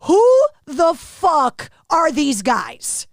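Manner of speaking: drawn-out, incredulous retold question; hammed-up slow astonishment; mock bewilderment. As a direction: stretch each word in stunned disbelief. Acted stunned reaction in retold story.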